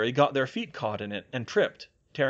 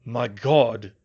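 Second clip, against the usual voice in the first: gasp; 7 dB louder than usual. A narrator's reading. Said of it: "Bill gasped".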